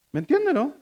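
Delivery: ordinary talk, nothing marked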